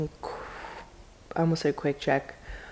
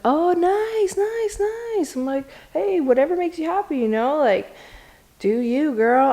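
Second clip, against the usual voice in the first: much higher hyped nice nice; overeager approval; 9 semitones higher than usual. Retold or imitated. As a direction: cover surprise with eager praise. Supportive reaction after surprise.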